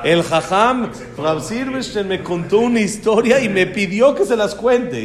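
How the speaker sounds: put-on a proud little boy showing off to his class; proud child boasting. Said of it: "llega como pavo real". (niño orgulloso presumiendo, más fuerte)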